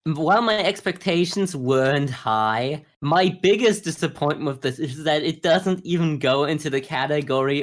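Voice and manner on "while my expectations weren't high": ordinary talk, nothing marked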